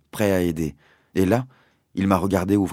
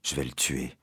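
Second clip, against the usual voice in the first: much softer, lower murmur of rage; 6 semitones lower, 8 dB quieter than usual. A narrator's reading. Father murmuring low that he will kill the captain. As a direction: quietly vow it under your breath. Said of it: "a-t-il murmuré à voix basse".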